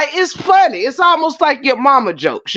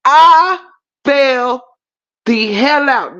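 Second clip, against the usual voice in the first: normal talking voice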